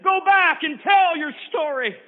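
high, loud, slow command. Acted. Keeper ordering the sister back to tell her story, loud and slow. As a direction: send her back with authority.

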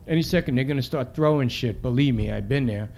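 plain reading voice

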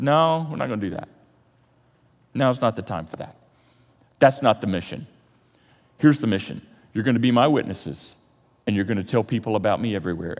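low, soft, steady authority; calm, firm answer. Acted. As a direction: quietly lay out the mission. Jesus calmly answers excited followers.

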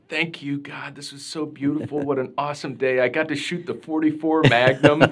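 breathless, high, whispered thanks to God building to a laugh; intense childlike prayer. Retold or imitated. Boy thanking God after a day of shooting, whispered then giddy. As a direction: pray like an awestruck kid thanking God. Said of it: "like, a really intense".

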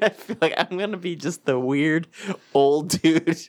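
usual speaking voice

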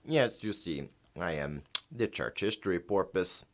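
lightly voiced porpoise on a phone line; a porpoise speaking through a phone; over the phone. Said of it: "We're gonna do a phone interview with them".

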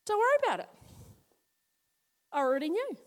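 lightly voiced Elisha, relaxed; breezy reassurance. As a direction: toss it off casually. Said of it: "Elisha's going".

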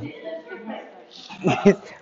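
breathy leftover chuckles, rising in pitch; trailing laughter. Real, not acted. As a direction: let the laugh peter out. Laughter carries on after the tip.

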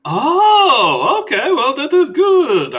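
high, loud, theatrical vampire greeting; Skurlock the vampire. Acted. Skurlock voice continues.

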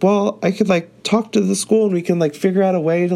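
mild imitation of an eager teen; eager high-pitched Lexi. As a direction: pitch her up high, rushing to offer help.

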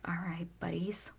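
hushed whisper to the birds; whispered greeting. Retold or imitated. Greeting two turtle doves softly, whispering. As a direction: whisper so as not to scare them.

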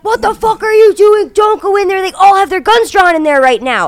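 loud, high furious officer; cop yelling. Retold or imitated. Officer shouting at realtor walking in, loud. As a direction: bark it at her going inside. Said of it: "he's like".

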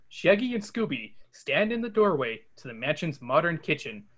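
ordinary delivery

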